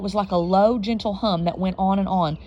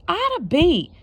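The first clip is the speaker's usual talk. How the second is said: exasperated scolding; 9 semitones higher, 3 dB louder, slower than usual. Acted. Mama exasperated by child's questions.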